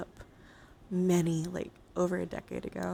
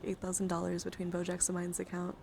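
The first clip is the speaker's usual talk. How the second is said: ordinary talk, nothing marked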